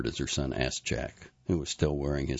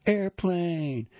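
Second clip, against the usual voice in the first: put-on high, drawn-out toddler call; little boy playing; 14 semitones higher than usual. A narrator's reading. Little Henry flying his spoon, gleeful high call. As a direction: call it out like a small child. Small child plays with spoon.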